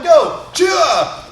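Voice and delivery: high, loud martial-arts kiai; kiai shout. Shown as a demonstration. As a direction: launch the drill with a sharp shout. Counting down to a kiai drill.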